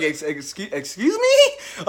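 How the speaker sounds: incredulous, laughing it off; mock-outraged laughing double take; laughing disbelief. Disbelief at the question.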